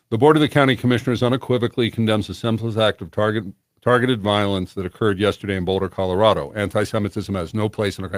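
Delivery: fast rushed reading without pauses; court reporter readback. Shown as a demonstration. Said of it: "like a court reporter reading something back".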